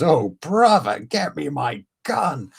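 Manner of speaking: high, hammed-up mock outrage; angry cartoon fish. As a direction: draw it out like an indignant cartoon fish. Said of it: "he already sounds so angry".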